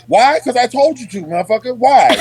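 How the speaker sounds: high, punched retort; mock-indignant answer to being questioned; acted exasperated authority. Sergeant answering soldiers who question orders, exasperated.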